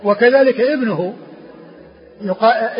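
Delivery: ordinary talk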